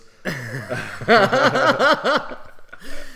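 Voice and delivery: loud, high belly laugh; real burst of laughter. Real, not acted. Bursts out at viewer's comment.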